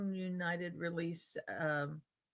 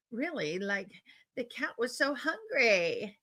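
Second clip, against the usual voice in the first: mock Valley Girl, hungry pushed louder; Valley Girl uptalk; 4 semitones higher, 5 dB louder than usual. Shown as a demonstration. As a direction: demo uptalk, hungry ringing out like a question. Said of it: "rising intonation at the end of sentences".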